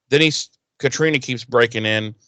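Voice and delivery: ordinary delivery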